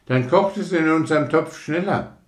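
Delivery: Junge. Acted. Junge spricht weiter.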